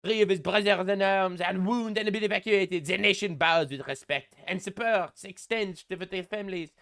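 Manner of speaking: mocking French accent read; Macron's French accent. Reading Macron's tribute post aloud, in a mock French accent.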